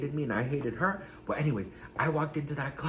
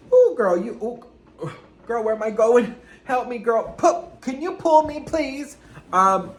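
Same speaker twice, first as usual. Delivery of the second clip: mock panic; 8 semitones higher, 8 dB louder, slower than usual. Mock struggle being pulled along.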